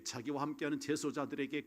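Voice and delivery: usual speaking voice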